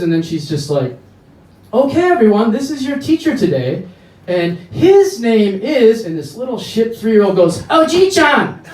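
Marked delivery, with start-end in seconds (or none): 1.7-6.0 high, loud preschool-teacher voice; cheerful teacher announcing. Retold or imitated. 7.6-8.6 put-on very high, loud child's shout; kid yelling.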